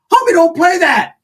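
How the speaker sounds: high, loud disbelief; mock outraged squeak. Telling his tio not to play, high outraged disbelief. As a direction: protest in comic shock. Confronting tio about gang.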